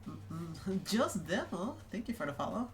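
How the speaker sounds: ordinary talk, nothing marked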